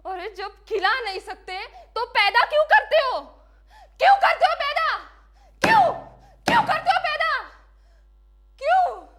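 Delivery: high, full-force accusing scream; desperate scream. Acted. Final desperate outcry before hanging. (चीख-चीखकर हताश सवाल)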